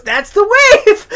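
loud, high, drawn-out mock cheer; James Earl Jones exclaiming. Retelling the actor's line at the ballgame, exclaimed. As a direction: call it out slowly, high and loud. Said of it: "but James Earl Jones goes".

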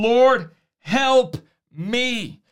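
drawn out and slow, a woman begging at Jesus' feet; slow pleading. Acted. A woman pleading again with Jesus, slow and drawn out. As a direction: stretch each word into a long plea. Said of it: "It wasn't a, a long, uh, drawn-out prayer".

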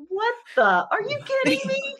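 high, disbelieving laugh through the words; can't believe what she's hearing; incredulous. Retold or imitated. Her reply to the mansplaining guy, laughing disbelief. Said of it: "And I'm like".

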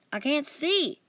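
a loud cry when the lights go out; yelled. A narrator's reading. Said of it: "I yelled".

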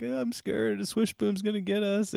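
put-on high, frightened little-kid whine; scared kids. Kids coming out of their room at night, scared.

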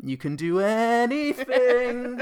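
slow, high, drawn-out mock inspiration; mock inspirational. Mocking the film's message of belief, slow. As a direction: stretch it out like a movie trailer.

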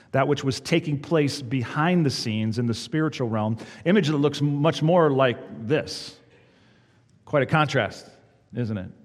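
normal talking voice

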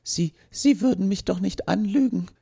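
begging not to be fooled; robot grabs the policeman and pleads into the radio; pleading robot. Acted.

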